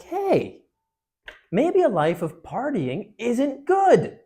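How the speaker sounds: high, loud, stretched-out discovery; exaggerated, slow dawning realization; mock revelation. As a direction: announce it like a sudden epiphany. World acted out having a revelation.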